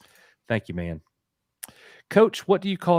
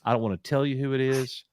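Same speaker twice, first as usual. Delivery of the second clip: ordinary talk, nothing marked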